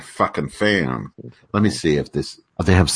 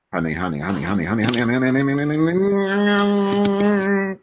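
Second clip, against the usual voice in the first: honey repeated faster until it revs into a high drone; engine-noise honey; 9 semitones higher, faster than usual. Shown as a demonstration. Host showing Cheryl the engine noise, revving and climbing. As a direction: rev the word up like an engine, climbing in pitch. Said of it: "If you say honey so fast, it turns into, like, an engine noise".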